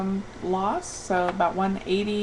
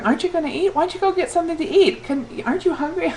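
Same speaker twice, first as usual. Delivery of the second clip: high, insistent mock nagging; imitating pestering surgeons; 9 semitones higher, faster than usual. Surgeons urging a patient to eat, imitated nagging. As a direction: pitch it up, urging question after question. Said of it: "all these bariatric surgeons going".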